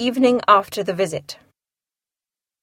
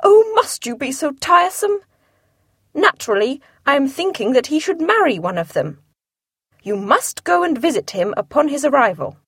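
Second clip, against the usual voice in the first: higher, impatient fussy wife; Mrs Bennet, exasperated; 4 semitones higher than usual. Acted. Wife nagging her husband to visit, higher and impatient. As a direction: scold him for being so tiresome.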